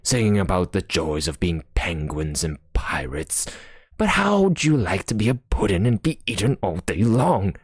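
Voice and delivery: ordinary delivery, nothing marked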